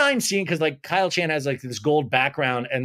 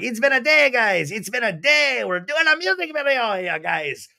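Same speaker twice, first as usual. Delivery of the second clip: high, loud mock Irish-ish Brock voice; 7 semitones higher, 3 dB louder than usual. Host imitating Brock greeting the girls, high and loud. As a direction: ham up Brock's failed accent, pitched high. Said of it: "So that is how I do Brock".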